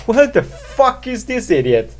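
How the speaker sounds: high-pitched imitation of bewildered listeners; mock baffled outrage. Imitating confused listeners judging him, mock outrage. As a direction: squawk it as an incredulous listener. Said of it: "they're like".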